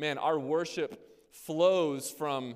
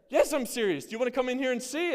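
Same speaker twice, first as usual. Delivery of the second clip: high, louder sarcastic retort; exasperated wife; 9 semitones higher, 4 dB louder than usual. Retold or imitated. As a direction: snap back impatiently. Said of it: "And she says".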